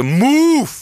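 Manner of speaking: high, loud barked order; a sudden high yell of Move; mock bouncer shout. Bouncer shoving people aside.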